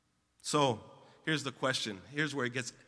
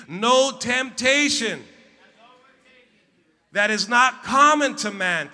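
loud slow group reading; 9 semitones higher, 11 dB louder, slower than usual. Real, not acted. Pastor reading the verse aloud with the church, slow and loud.

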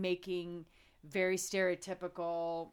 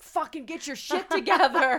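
louder, higher retold telling-off; mock angry snap; 7 semitones higher, 9 dB louder than usual. Telling off the nurses fighting over her friend, loud. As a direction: bark it at the nurses. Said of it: "I'm like".

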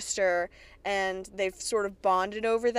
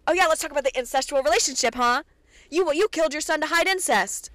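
fast, higher, louder taunting accusation; mock accusation; 6 semitones higher, 4 dB louder, faster than usual. Alice throwing the incest at the Blossoms, fast mock taunt. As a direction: fire it off like a gotcha, fast and pitched up.

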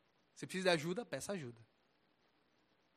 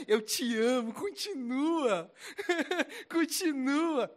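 put-on syrupy kids' character, drawn-out and louder; Barney; 6 semitones higher, 6 dB louder, much slower than usual. Mock Jesus telling sinners to carry on, exaggerated cartoonish sweetness. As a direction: coo indulgently with a goofy giggle, stretching the words. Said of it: "o Barney vai falar assim com você".

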